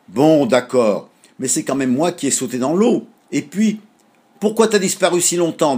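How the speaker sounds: grudging, then reproachful. A narrator's reading. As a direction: give in, then push back. Child concedes then argues. (concession boudeuse puis reproche)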